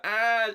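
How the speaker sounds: high loud yelp of a man falling over; mock yelp. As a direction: imitate his cry as he falls, loud and high. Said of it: "Oh, I can't do it".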